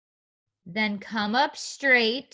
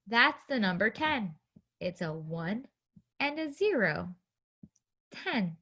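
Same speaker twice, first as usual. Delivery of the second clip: soft explaining; 2 semitones lower, 8 dB quieter than usual. Shown as a demonstration. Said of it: "teacher explains softly".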